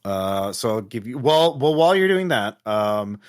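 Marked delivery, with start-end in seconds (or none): none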